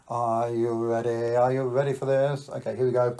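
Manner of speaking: lingering showman's build-up; suspenseful build-up. Real, not acted. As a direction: stretch the words to tease the audience. Teasing build-up before showing card.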